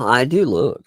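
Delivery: ordinary talk, nothing marked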